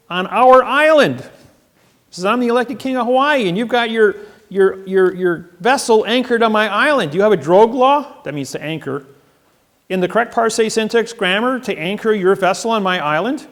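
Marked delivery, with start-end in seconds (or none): 0.1-1.2 much higher and louder, slowed; telling the guard off, loud and high; raised declaration. Retold or imitated. Telling off the marshals. 2.3-8.2 higher and louder, declaring; loud proclamation. Retold or imitated. Declaring himself king to guard. 9.9-13.5 higher and louder, declaring; loud proclamation. Retold or imitated. Questioning the guard.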